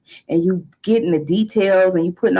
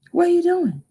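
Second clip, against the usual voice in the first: lightly voiced granddad, pitched up; granddad impression; 8 semitones higher than usual. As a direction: imitate a granddad's questioning, higher than usual. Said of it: "My granddaddy used to say this".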